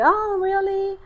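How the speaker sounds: high, rising note of surprise; surprised interest. Retold or imitated. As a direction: lift it high with curious surprise. Reacting to the driver's move.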